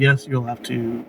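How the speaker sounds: normal talking voice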